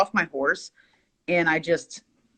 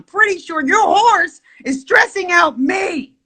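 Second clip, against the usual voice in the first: screaming; 11 semitones higher than usual. Retold or imitated. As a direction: yell it right back at him, outraged. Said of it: "sorry, I'm screaming in your ear".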